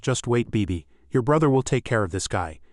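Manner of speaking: plain reading voice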